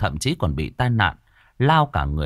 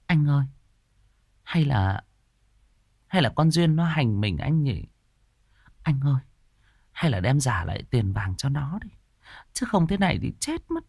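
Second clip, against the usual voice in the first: pleading wife; 4 semitones higher than usual. A narrator's reading. Wife begs her husband to return the gold. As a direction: coax him gently. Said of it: "Tuyết mới bảo".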